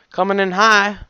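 high, loud kitchen call. A narrator's reading. Cook warning partner of an incoming flapjack, loud call. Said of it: "Coconut called".

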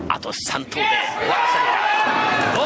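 high and loud, rising with the moment; excited call. Real, not acted. Announcer urges her toward the title, excited call. Announcer calling the final frame.

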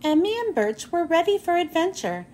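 usual speaking voice

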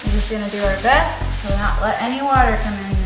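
ordinary delivery

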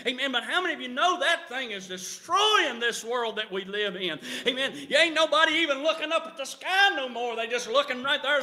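ordinary talk

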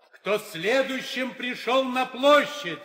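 insistent repeat. Acted. Herald repeating his question to the silent suitors, insistent and loud. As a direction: demand an answer the second time. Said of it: "Я спрашиваю ещё раз".

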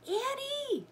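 high-pitched call of alarm; storybook boy crying out a warning. A narrator's reading. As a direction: call her name urgently, pitch way up. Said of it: "cried Jack".

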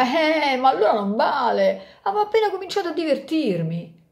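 high, whiny mock complaint; playful protest. Cyclamen lady complaining the fun is over, mock whine. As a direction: protest like a child called in from play.

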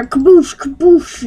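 punchy mouth-made cannon blast; imitated explosion. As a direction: fire off two explosive booms. Imitates cannon blasts of pirate ship.

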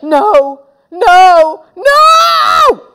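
three no's building to a shriek; from loud protest to high, drawn-out yell; building outrage. Acted. Peter realises he was tricked.

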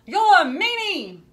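high, loud, indignant yell. A narrator's reading. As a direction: shout it out, jam-covered and furious. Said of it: "He scraped himself off and yelled".

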